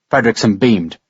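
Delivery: usual speaking voice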